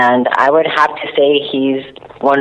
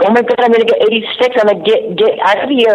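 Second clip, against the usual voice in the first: high, fast, jittery retold panic; nervous panic; 10 semitones higher, faster than usual. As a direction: blurt it out, scared of getting caught. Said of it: "I would get so nervous".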